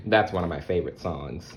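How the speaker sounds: plain reading voice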